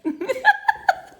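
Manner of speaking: high, loud laughing burst; burst of laughter. Real, not acted. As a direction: crack up at the husband's joke. Laughs at husband's fear.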